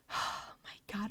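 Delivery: very soft, slow, sighed out; exasperated sigh. Real, not acted. Recalling a terrible job, exasperated whispered sigh. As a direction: let it out on a weary breath.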